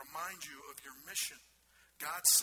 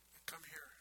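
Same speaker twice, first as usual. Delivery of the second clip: hushed invitation; 10 dB quieter than usual. Acted. Paul's tender invitation.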